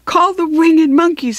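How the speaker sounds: high, loud character voice; Tin Woodman's suggestion. A narrator's reading.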